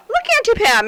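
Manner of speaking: put-on high-pitched child with a light French accent; imitated slight French accent. Nephew calling his aunt to see his find, slight French accent. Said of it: "he has a little French accent".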